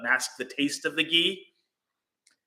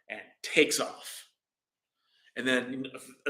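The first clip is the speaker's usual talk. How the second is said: usual speaking voice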